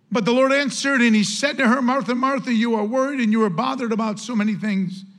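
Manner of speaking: preacher reading the verse to the church; scripture reading. Acted.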